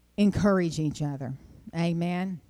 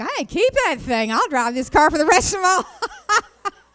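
higher and louder, laughing through it; joking glee, breaking into a laugh; gleeful joke; 9 semitones higher, 8 dB louder than usual. Retold or imitated. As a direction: crow about keeping the fancy car. Joking about luxury loaner car.

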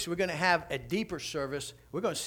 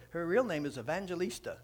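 ordinary talk, nothing marked

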